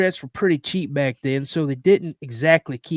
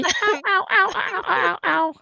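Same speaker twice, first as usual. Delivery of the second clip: squeaky high little yelps; high-pitched mock yelps; high yelping. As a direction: yip it high like a fox.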